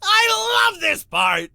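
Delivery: high, loud, slow squeal of delight; gleeful shout. Acted. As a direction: shout it with giddy joy. Gleeful demon watching the summoning.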